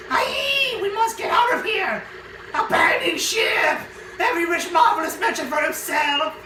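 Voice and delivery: frantic shouting. Acted. Crew flees the ghost shouting, high panic. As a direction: scream to abandon ship.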